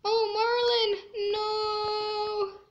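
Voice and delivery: dismayed cry. Real, not acted. Dismay at game scene.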